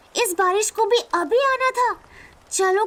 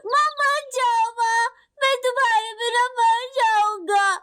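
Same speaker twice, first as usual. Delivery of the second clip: put-on crying child. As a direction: beg through sobs not to be left. Child cries as mother leaves. (सिसकते हुए बच्चे की विनती)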